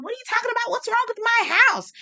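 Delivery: very high-pitched imitated protest; high, mock-offended comeback; mock defensive. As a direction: play up the affronted questioning. Imitates Kyle's defensive outburst.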